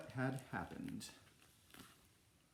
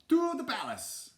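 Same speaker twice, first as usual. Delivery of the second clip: high, loud heroic cry; hammed-up charge call, pitched way up; rallying call; 14 semitones higher, 6 dB louder than usual. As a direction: shout it like leading a charge. Hero's rallying call in story.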